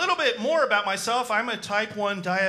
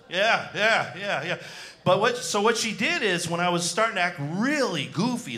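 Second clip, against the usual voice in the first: usual speaking voice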